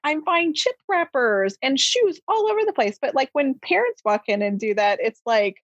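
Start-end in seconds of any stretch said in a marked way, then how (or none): 0.0-3.0 exasperated parent. Retold or imitated. Parent scolding kids in retold retort.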